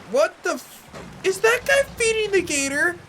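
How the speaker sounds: high, loud shocked yelp; jolted by the gator, real alarm; real surprise, voice shooting up; startled outcry. Real, not acted. Gator suddenly attacks in game.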